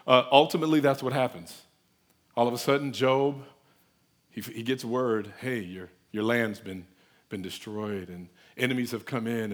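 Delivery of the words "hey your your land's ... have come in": low, subdued messenger voice; reluctant bad news. Messenger breaking news of disaster to Job, subdued. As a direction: break it softly, as to a boss.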